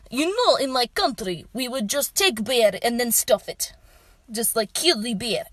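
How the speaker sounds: measured mock Russian accent; deadpan delivery in a put-on Russian accent; thick Russian accent. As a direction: imitate a Russian exchange student explaining. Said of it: "a thick Russian accent".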